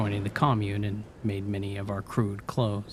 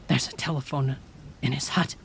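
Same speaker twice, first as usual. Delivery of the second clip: Cheryl explaining; 5 semitones higher than usual. A narrator's reading. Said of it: "she continued".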